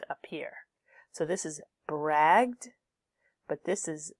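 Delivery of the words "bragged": "bragged" drawn out with a hard G before D. Shown as a demonstration. Teacher contrasts G with J, "bragged" said with a hard G. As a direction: stress "bragged", hard g then d. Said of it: "as opposed to a G sound".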